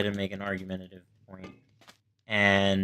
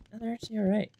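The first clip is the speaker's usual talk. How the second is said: higher, casual mock classmate voice; reassuring classmates; 12 semitones higher, much faster than usual. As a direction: brush off his worry lightly. Classmates reassure embarrassed professor.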